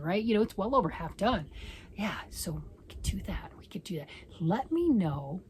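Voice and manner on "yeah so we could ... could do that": scheming hushed whisper. Real, not acted. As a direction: whisper the plan to yourself. Scheming to herself.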